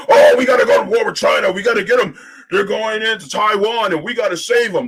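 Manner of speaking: loud, hammed-up hawkish rallying call; mock war cry. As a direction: belt it out like a cable pundit beating the war drums. Mocking pro-war media voices.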